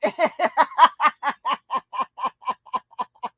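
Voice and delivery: long helpless laughing fit. Real, not acted. Host unable to play the voiceovers, laughing. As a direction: uncontrollable run of chuckles. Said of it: "I'm just laughing".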